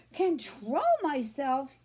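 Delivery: loud, slow outraged yell; a girl yelling at her father. Acted. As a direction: throw his words back at him. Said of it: "yelled Fern".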